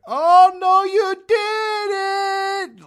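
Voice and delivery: shrieking falsetto oh no you didn't; sassy shocked squeal; scandalized shriek. Retold or imitated. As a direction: gasp it out way up high and loud, scandalized. Scandalized crowd reacts to pope.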